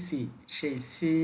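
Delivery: সি said slowly with an ordinary level tone; normal level tone. Shown as a demonstration. Teacher showing learners the normal tone of সি. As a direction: draw out সি calmly and evenly. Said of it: "এটার উচ্চারণ normal হবে".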